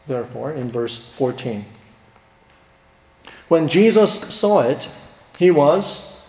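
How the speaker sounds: ordinary talk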